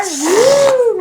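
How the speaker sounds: high loud woo, belted; high whoop. Shown as a demonstration. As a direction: let out a bright woo. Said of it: "think about that here".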